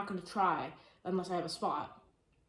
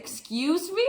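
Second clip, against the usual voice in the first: high, louder affronted reaction; retold indignation; 9 semitones higher, 5 dB louder, much slower than usual. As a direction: act out being taken aback and offended. Retells her reaction to unwanted touching.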